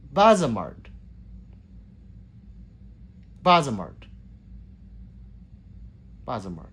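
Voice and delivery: "Bazamart" with a short, open first vowel; A as in meh. Shown as a demonstration. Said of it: "as in meh".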